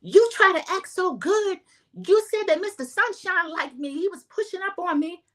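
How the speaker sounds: high-pitched mock yell; high, heated quote of someone yelling; retold yelling. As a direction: yell it in imitation of an irate castmate. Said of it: "Jassy is yelling".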